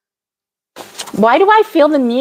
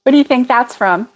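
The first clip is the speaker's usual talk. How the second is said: ordinary delivery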